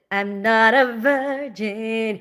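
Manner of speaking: "I'm not a virgin" drawn out slowly, each word weighted; slow emphatic repeat. Real, not acted.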